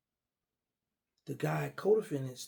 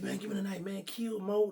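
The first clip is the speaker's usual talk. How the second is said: higher, heated street voice; young hothead voice, pitched up, demanding the knife; DC youngster talk; 7 semitones higher than usual. Retold or imitated. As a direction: mimic a hot-headed DC youngster. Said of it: "That's how the D C, uh, youngsters talk".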